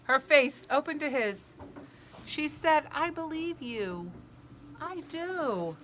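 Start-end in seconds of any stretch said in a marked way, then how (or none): 2.9-5.8 higher and softer sincere reassurance; softly. Acted. Sophie softly reassures the hurt giant, tender and quiet. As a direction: say it gently so he trusts you. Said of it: "She said it so softly".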